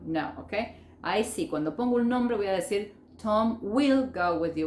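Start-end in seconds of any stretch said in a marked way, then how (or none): 3.2-4.7 slow, "will" sounded as a whole word; slow demonstration with a full "will"; full form. Shown as a demonstration. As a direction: spell out "Tom will" without contracting. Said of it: "decir la forma full form".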